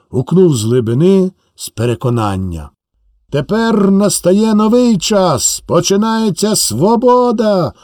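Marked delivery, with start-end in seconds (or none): none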